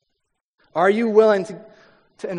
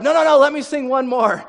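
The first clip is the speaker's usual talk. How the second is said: high, loud begging; mock desperate contestant pleading loudly; 7 semitones higher, 6 dB louder than usual. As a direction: beg to sing once more, pushy. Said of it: "usually they're like".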